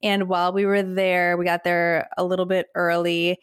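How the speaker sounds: ordinary delivery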